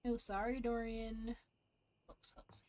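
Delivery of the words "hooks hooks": whispered under breath; whispering. Real, not acted. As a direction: whisper it to yourself. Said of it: "muttering to self while searching".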